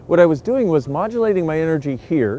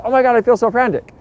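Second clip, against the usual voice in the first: frantic; 7 semitones higher, 5 dB louder than usual. Shown as a demonstration. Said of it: "an energy that's like this".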